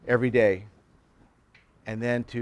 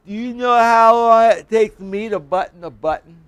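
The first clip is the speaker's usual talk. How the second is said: higher, louder pointed question, imitated; mimicking classmate; 8 semitones higher, 7 dB louder than usual. Classmate presses the pastor about buttoning, imitated. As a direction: press the lesson home. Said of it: "I'm gonna mimic him".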